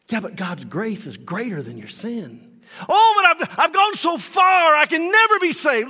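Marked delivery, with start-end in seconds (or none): 2.9-5.9 higher, louder anguished protest; mock a hopeless sinner wailing; despairing cry. Preacher voices a hopeless listener's anguished protest, loud and high.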